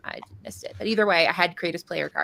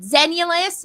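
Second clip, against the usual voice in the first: pitched up and louder; retold announced name; 8 semitones higher, 6 dB louder, slower than usual. Customer telling shop lady an odd name, high and emphatic.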